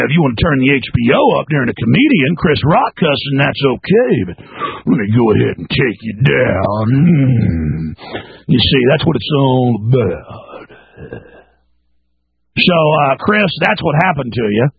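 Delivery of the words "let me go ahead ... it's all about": low, slow mock cop voice with a groan; menacing cop. Mocking the cop who arrests you, low and slow. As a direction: sink deep and drawn out like a smug officer.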